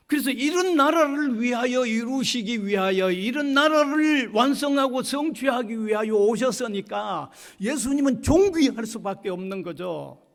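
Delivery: high, forceful pulpit voice; emphatic preaching. Real, not acted. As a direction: drive the conclusion home insistently.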